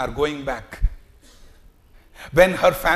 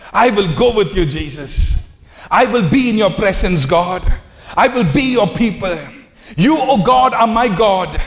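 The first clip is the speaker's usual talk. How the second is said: loud high preaching vow; fervent declaration; 4 semitones higher, 7 dB louder than usual. Acted. Preacher voicing a believer's vow to Jesus, loud and high. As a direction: proclaim each line as a promise to God.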